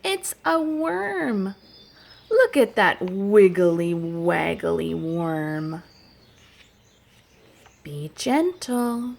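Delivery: rhythmic, sing-song story chant; reading to children. Acted. Teacher reading a nature rhyme to children, sing-song. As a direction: read it like a picture book for little kids.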